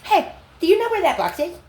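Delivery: mock-innocent coworker asking, high and loud; imitating the teacher. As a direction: parrot her nosy question, up high. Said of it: "this teacher says to me".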